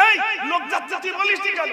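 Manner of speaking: high, loud scolding with a laugh in it; mother scolding her son for not warning her; angry scolding. Retold or imitated. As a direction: tell the son off sharply. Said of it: "ছেলের উপরে রেগে".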